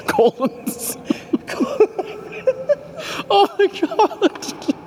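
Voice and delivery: squeaky high breathless laughing; laughing too hard to speak. Real, not acted. Host cracks up on live air.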